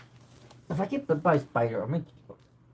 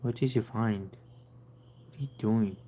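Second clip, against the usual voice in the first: soft coaxing; 4 semitones lower, 7 dB quieter, much slower than usual. Real, not acted. Whispering to hiding cat.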